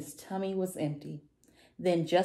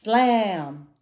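emphatic slam; 4 semitones higher, 7 dB louder than usual. A narrator's reading. Storybook sound word, acted.

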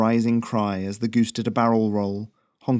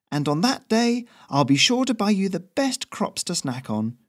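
higher, easygoing character voice for the courier; breezy courier voice; 9 semitones higher than usual. A narrator's reading. Courier smoothing things over with the new local, higher breezy voice. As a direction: raise the pitch and play it chummy and casual.

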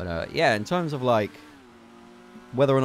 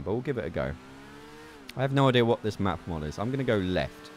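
ordinary talk

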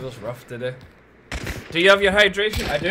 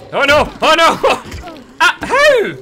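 much higher and louder, panicked; alarmed yelp; 12 semitones higher, 10 dB louder, much slower than usual. Real, not acted. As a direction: yelp as the dog bites you. Bitten by a dog in game.

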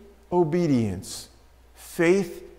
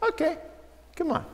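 gentle invitation; 11 semitones higher, 9 dB quieter, faster than usual. Retold or imitated. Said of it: "I just think of Jesus as saying".

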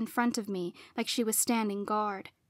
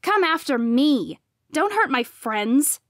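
loud, forceful and protective; higher, louder, defiant demand; angry challenge; 4 semitones higher, 6 dB louder than usual. A narrator's reading. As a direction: confront a rival who hurt your friends. Confronting rival in a fight.

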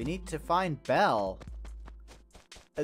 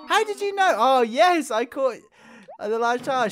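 high, loud outburst of surprise; acted astonishment; 6 semitones higher, 7 dB louder than usual. Game character caught out by the right guess, astonished. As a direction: burst out as if found out. Character loses the photo bet.

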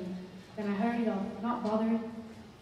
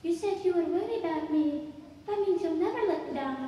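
put-on high, raised child voice; calm child; 9 semitones higher, 5 dB louder than usual. A narrator's reading. Child answering the adult's question, calmly insistent. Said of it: "The child answered calmly".

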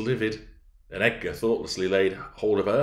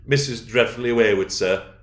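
raised voice to someone outside; projected, slightly louder call; 3 semitones higher, 6 dB louder than usual. Acted. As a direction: call across the yard to him. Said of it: "I called out".

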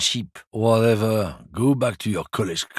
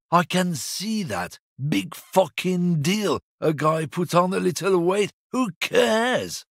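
high defensive whine; 9 semitones higher than usual. Acted. Driver defensive about his weight.

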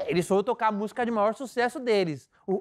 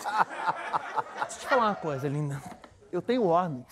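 slow, lowered condescending warning; mock-firm warning; 5 semitones lower than usual. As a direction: lay down the rule slowly, half in jest. Acting out a firm warning to guest.

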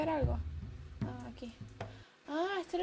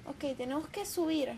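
usual speaking voice

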